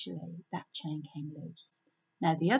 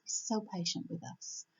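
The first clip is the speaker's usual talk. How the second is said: same line again, much softer, sincere; softer repeat; 2 semitones lower, 7 dB quieter than usual. Real, not acted. God's patience repeated quietly to the listeners. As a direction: let it sink in gently.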